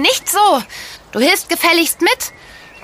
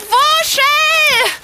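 calling out; 12 semitones higher than usual. Acted. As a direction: call the dog from far away. Calling the runaway dog.

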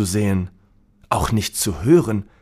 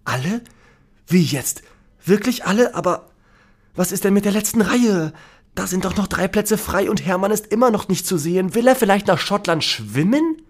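confused; 8 semitones higher than usual. Acted. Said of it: "total durcheinander".

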